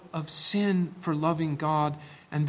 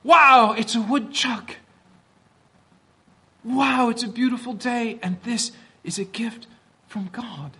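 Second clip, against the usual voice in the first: put-on childlike wonder; 6 semitones higher than usual. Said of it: "say with Bella".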